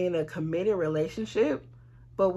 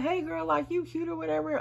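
lightly voiced higher girly greeting; a hint of a bubbly girlfriend voice; imitating the woman; 9 semitones higher, 3 dB quieter than usual. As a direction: mimic her friendly hey girl, pitched up. Voicing the friendly woman.

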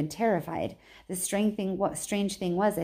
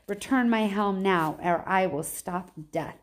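ordinary delivery, nothing marked

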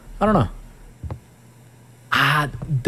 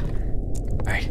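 whisper; 4 dB quieter than usual. Real, not acted. Sneaking through dark nest.

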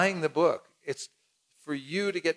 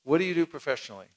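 brisk, matter-of-fact asking; quick question; much faster than usual. Shown as a demonstration. Book distributor quizzing the stranger quickly. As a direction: fire off the question fast. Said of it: "we ask people".

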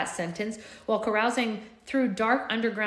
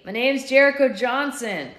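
slow, loud call across the distance; 6 semitones higher, 7 dB louder than usual. A narrator's reading. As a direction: project it to the guards. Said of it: "I called to them".

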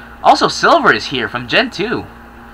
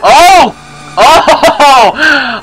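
loud high mock laugh cries; imitating the laugh; 9 semitones higher, 6 dB louder than usual. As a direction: mimic the villain's shrill laugh. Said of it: "why do you have to laugh like".

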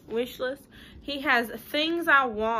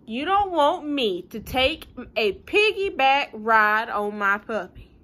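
pleading pigeon; 8 dB louder than usual. Acted.

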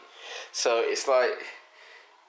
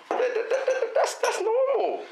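giggling through the words; laughing too hard to get it out; 4 dB louder, slower than usual. Real, not acted. Laughing while stammering a reply.

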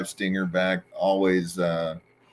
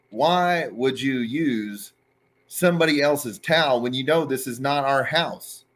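exasperated disbelief; 5 semitones higher than usual. Retold or imitated. She asks Sags why he used the towel, exasperated. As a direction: spell out how wrong it is. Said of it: "And I'm like".

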